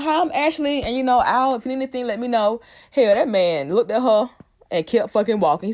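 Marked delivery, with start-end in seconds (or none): 0.0-2.6 high, sweet helpful greeting; Ashley greeting. Retold or imitated. Ashley offering Uncle Earl help, sweet and high. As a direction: lift way up, eager and polite. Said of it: "She's like".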